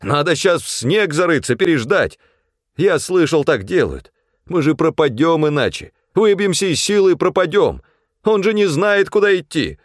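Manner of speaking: high, agitated urging; urgent pleading. A narrator's reading. Supply man clutching Fyodor's coat, pleading urgently to shelter. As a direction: talk them round before it is too late. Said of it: "быстро заговорил".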